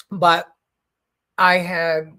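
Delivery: chewing while talking; words round a bite of food; mouth full. Real, not acted. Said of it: "I'm sorry, I'm chewing the".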